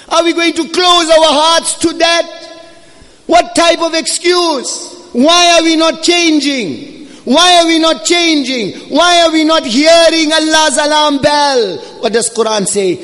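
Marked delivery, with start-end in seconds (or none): 0.1-11.8 high, urgent preaching cry; impassioned pleading. Real, not acted. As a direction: plead with the congregation at full pitch. Anguished exhortation at climax of sermon.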